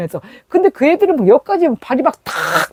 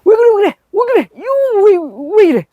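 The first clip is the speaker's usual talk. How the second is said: high alarmed yelling, voice pitched way up; father's alarmed shout; 10 semitones higher than usual. Retold or imitated. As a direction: shout it like a father running to his daughter.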